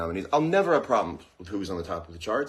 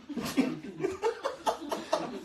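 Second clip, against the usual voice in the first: breathy high laugh; 8 dB quieter than usual. Real, not acted. Laugh at applesauce joke.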